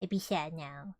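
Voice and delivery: low, soft, glum donkey voice; very sad donkey. Retold or imitated. Reader mimicking gloomy Eeyore against Shrek's donkey, sad. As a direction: drop it low and dejected. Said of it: "this one's just like".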